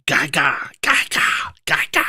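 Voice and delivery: imitating a screaming fan, held-back volume; half-hearted mock fan screams, repeated in bursts. Co-host imitating a hysterical fan outside the hotel, mock screaming. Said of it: "this woman was screaming".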